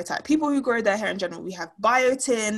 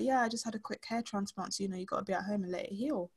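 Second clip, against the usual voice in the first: quiet; 11 dB quieter than usual. Retold or imitated. Coworker quoted quietly.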